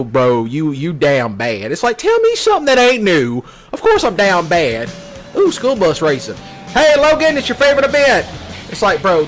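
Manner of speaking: ordinary talk, nothing marked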